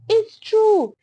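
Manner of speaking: high, loud insistent cry; shouted. A narrator's reading. A woman in the crowd cuts through the noise, shouting high. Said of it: "somebody else shouted".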